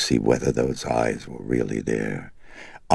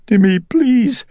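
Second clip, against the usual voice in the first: high, loud, tearful pleading; frightened and tearful; 9 dB louder than usual. A narrator's reading. Mother begging her son not to cast her out, sobbing. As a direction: cry out to him, terrified of the dark. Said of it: "grew frightened and tearful".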